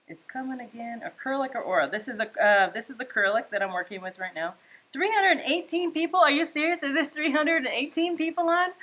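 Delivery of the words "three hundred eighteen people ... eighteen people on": excited high disbelief; can't believe the viewer count; surprised. Real, not acted.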